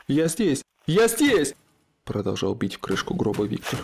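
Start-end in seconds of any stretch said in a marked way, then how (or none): 0.1-0.6 loud shout for help; calling out. A narrator's reading. Trapped in coffin, calling out. 0.9-1.5 much higher, louder scream; desperate scream. A narrator's reading. Trapped in coffin, calling out.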